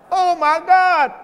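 high, loud, slow cry of wonder; awed outburst. Real, not acted. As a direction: let it burst out in awe. Preacher overcome.

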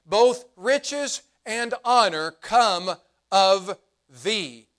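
ordinary talk

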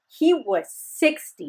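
slow, stunned repetition; slow disbelief. Real, not acted. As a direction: spell it out in disbelief. Repeating shocking fact slowly.

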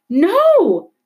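high, loud desperate cry; a girl begging the policeman; cried out. A narrator's reading. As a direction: scream it to stop him. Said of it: "Isabel cried".

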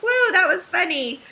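nonchalant imitation. Daughter brushing off her river scare, imitated as breezily nonchalant. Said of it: "She was totally nonchalant about it".

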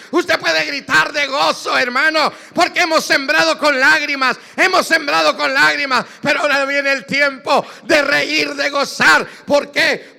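high, loud shouted preaching; full-force Pentecostal sermon; fiery preaching. Acted. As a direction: preach it at the top of your voice.

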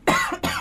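coughing after swallowing wrong; sudden cough from a drink gone down wrong; choking cough. Real, not acted. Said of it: "Disculpen que me ahogué". (tos de atragantamiento)

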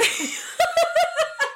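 high-pitched burst of laughter; helpless laugh. Real, not acted. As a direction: crack up mid-story. Cracking up at Adam and Eve.

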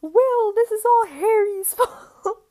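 high, loud, laughing through the words; high laughing outcry; laughing blame. Retold or imitated. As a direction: blame him while cracking up. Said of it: "And I'm like".

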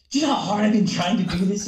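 loud, high-pitched tirade; mock indignation. Kid to coach after being corrected, mock indignant protest.

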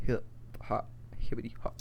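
whispered hip hop rhyme; whispering. Pretend. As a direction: breathe the rhyme out softly.

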